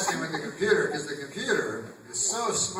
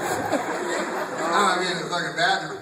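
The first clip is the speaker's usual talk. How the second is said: usual speaking voice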